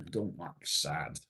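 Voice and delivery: ordinary talk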